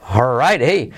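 ordinary delivery

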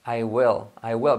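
WILL sounded almost "well", strong L. Shown as a demonstration. Teacher demonstrating to learners, WILL with an E sound. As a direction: say WILL with an E vowel, low. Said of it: "suena casi como una E".

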